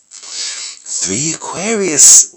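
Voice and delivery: high, slow and amazed; impressed. Real, not acted. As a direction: savour it with wonder. Impressed by the lineup.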